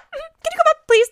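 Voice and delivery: wife asking husband upstairs, high and sweet; high sing-song plea. Retold or imitated. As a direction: ask in a high fake-sweet voice. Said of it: "I was like".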